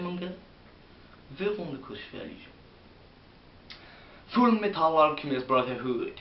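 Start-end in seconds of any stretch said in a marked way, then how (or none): 4.3-6.1 lightly hammed-up flamboyant English pronunciation. Vlogger teasing viewers with a camp accent. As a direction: lilt the title in a playful camp accent.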